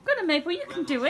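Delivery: encouraging dog. Real, not acted. Coaxing the dog.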